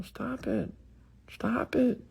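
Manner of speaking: high, soft squeaky plea; squeaky stop it. Real, not acted. Begging his audience to stop categorizing, high soft squeak. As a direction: squeak it out in a put-on voice. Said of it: "repeated stop it, pitched up".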